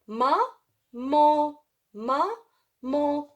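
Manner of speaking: "Ma. Mon." as two separate held syllables. Shown as a demonstration. Teacher breaking down maman for pupils, separated syllables. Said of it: "A N, elle se prononce en".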